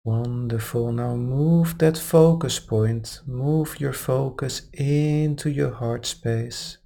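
calm, unhurried meditation guide; guided meditation. Acted. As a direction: ease them gently into relaxation. Meditation guidance resumes after aside.

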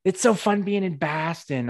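mock tourist Boston accent, high and drawn out; slow, high-pitched, hammed-up fake Boston accent. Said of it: "doing the Boston accent to me".

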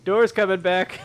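loud, high mock shout; high-pitched imitation of a yell; imitated yell. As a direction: mimic someone yelling the news across a house. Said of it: "yell out to the rest of the group".

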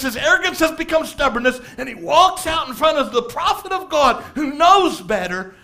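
usual speaking voice

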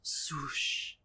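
whispered swoosh; soft breathy whoosh; swoosh sound effect. Pretend. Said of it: "Whenever they take off their clothes".

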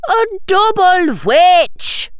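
put-on high, drawn-out girlish announcement; excited insistence. Tracy answering Hermione's question, excited insistence. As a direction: proclaim it slowly in a high voice.

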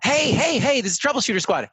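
high, excited hailing; eager computer. Acted. Computer appearing in the squad's huds, excitedly hailing them. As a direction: burst in, pitched well up, calling for attention. Computer bursts into everyone's heads.

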